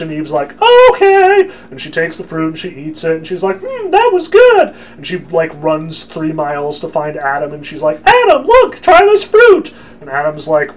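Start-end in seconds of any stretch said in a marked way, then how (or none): 0.6-1.5 very high, loud falsetto woman; hammed-up squeal of eager agreement; mock naive Eve. Eve cheerfully agreeing to the serpent, mock falsetto. 3.6-4.7 very high, loud falsetto woman; hammed-up squeal of eager agreement; mock naive Eve. Eve cheerfully agreeing to the serpent, mock falsetto. 8.0-9.7 very high, loud falsetto; mock excited Eve. Eve urging Adam to try the fruit, mock-excited falsetto.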